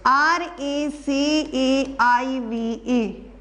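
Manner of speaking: letters spelled out slowly one by one; slow spelling out. Shown as a demonstration. Teacher spelling receive for students, slow and deliberate. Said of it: "receive".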